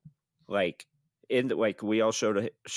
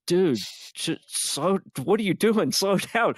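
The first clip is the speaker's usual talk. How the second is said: alarmed plea; 7 semitones higher, slower than usual. Retold or imitated. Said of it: "we're like".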